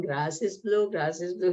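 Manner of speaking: ordinary talk, nothing marked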